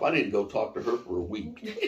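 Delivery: ordinary talk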